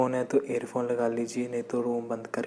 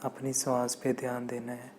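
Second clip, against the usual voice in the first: guided meditation; 7 dB quieter than usual. Acted. Meditation teacher leading listeners to watch the breath, calm guided meditation. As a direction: guide breathing calmly, letting phrases stretch out.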